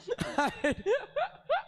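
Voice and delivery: high burst of laughter; big laugh. Real, not acted. Laughs at his own story.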